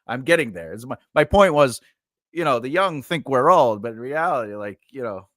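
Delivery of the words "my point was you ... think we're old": ordinary talk, nothing marked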